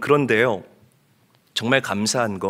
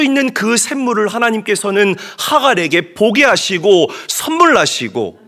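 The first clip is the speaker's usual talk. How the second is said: high, louder, impassioned sermon climax; fervent preaching; 8 semitones higher, 6 dB louder than usual. Acted. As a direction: drive the promise home to the congregation. Preaching at full force. (열정적으로 외치는 설교)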